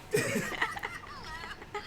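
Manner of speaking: high gremlin cackle; evil cackle. Acted. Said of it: "you hear the cackle, just the evil cackle".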